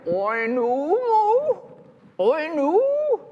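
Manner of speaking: high oink melting into a long moo; pig's failed oink. A narrator's reading. Storyteller as pig that tried again, high botched oinking. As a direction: struggle to oink but slip into mooing. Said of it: "tried again".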